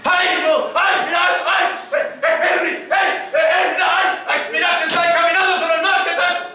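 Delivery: high, panicky cries of men seeing a ghost; acted panic, high-pitched yelps; frightened screaming. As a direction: cry out in hammed-up terror, stammering "eh, eh". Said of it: "Empezaron a gritar".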